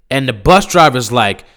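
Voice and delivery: usual speaking voice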